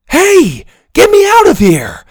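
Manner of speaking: put-on high, loud boy's shout. A narrator's reading. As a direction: yell for help. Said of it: "Ben shouted".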